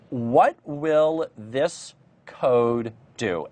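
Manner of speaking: very slow, spaced-out question; talking slowly. Real, not acted. Teacher puts a question to lagging online viewers, very slowly. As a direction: draw out each word so viewers keep up. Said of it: "talking a bit slowly here".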